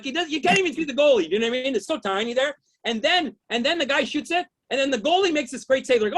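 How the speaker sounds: ordinary talk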